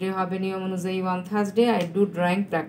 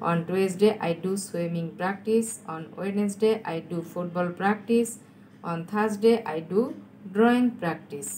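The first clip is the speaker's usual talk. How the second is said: very slow, measured reading; slow dictation; much slower than usual. Real, not acted. Teacher repeating answers for students to write, slow dictation. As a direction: dictate each sentence slowly, pausing between phrases. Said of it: "আমি আস্তে আস্তে বলছি".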